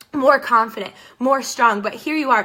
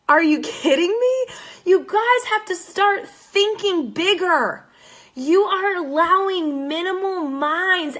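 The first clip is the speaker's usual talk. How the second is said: higher and louder, slowed and pleading; exasperated outcry; 7 semitones higher, 3 dB louder, slower than usual. Real, not acted. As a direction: cry out in disbelief, then drag the words. Exasperated outburst at her critics.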